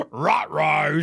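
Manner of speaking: a light imitation of a cartoon dog's alarm; Scooby-Doo. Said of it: "says Scooby fucking Doo".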